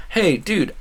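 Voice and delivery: ordinary talk, nothing marked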